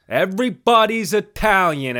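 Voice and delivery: Italian accent, hammed up for the joke; mock Italian accent. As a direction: shout it like a proud Italian uncle.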